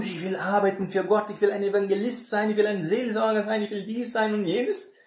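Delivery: eager would-be evangelist. Retold or imitated. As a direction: gush about serving God like an overzealous beginner. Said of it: "die wollen Sachen für Gott tun".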